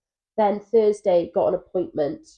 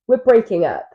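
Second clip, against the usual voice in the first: lightly mock couple's video voice; higher, louder take-off of a couple's video; YouTube couple announcement; 4 semitones higher, 7 dB louder than usual. Imitating a YouTube couple announcing a split, mock dramatic. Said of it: "where couples are like".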